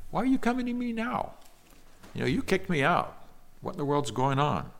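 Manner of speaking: high-pitched, baffled protest; incredulous Jephthah. Acted. Jephthah questions the elders who rejected him, incredulous. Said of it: "Jephthah had the same reaction".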